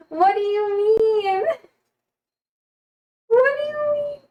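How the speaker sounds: hammed-up high-pitched protest; mock exasperation. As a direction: wail it in playful disbelief. Mock exasperation at chat message.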